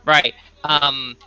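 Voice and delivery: normal talking voice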